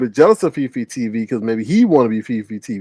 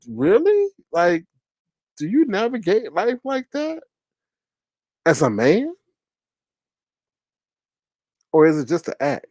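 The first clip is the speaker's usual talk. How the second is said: incredulous question; 7 semitones higher than usual. Retold or imitated. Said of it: "I'm like".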